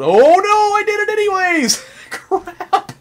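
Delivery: very high, loud comic panic; panicked yelp. Real, not acted. Player who woke the statue, high laughing panic. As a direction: squeal it in alarm through laughter.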